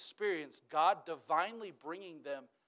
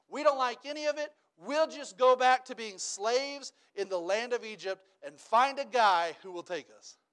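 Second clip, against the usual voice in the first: ordinary talk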